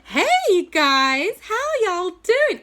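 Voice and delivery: high, loud, slow gushing hello; gushing auntie. Acted. J B thrilled to see the kids, high gushing hello. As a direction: greet them like a delighted auntie.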